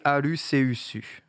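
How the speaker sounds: "Aluceusu" slowly, each syllable open, final u sounded; Japanese pronunciation. Shown as a demonstration. Co-host revealing the Japanese name, "Aluceusu" syllable by syllable. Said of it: "Le nom japonais du Pokémon est".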